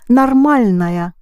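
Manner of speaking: нормальная with first vowel as "a", nar-MAL-naya; unstressed O reduced. Shown as a demonstration. Teacher corrects student, нормальная with о reduced to "a". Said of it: "don't pronounce no o because it's unstressed".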